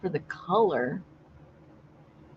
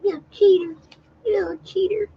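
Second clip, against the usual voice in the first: high, playful Texas imitation; mock Texas accent; 10 semitones higher, faster than usual. Teasing a friend as the other woman would, mock Texas accent. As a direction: put on a high Texas voice to tease. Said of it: "Texas accent?".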